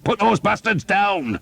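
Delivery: high, slow furious yell; angry shout. Acted. Skeet cursing the French horsemen, furious high shout. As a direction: bellow it at the archers. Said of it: "Skeet shouted".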